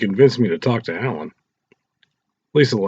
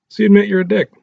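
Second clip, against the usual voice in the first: higher, louder smirking jab; 12 semitones higher, 7 dB louder, faster than usual. Acted. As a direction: tease him with a smirk you can hear. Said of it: "Alan had a smirk as he said this".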